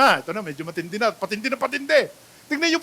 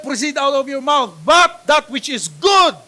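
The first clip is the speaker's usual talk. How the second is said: louder, ending in a slow shout; emphatic verse reading; 5 semitones higher, 7 dB louder, slower than usual. Acted. Reading Ephesians to the congregation, building to a slow shout. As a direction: proclaim it, shouting the last words.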